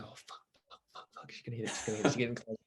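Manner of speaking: retold nervous hush, very soft; under your breath, imitating anxious onlookers; hushed suspense. Boys watching her swim towards it, hushed suspense.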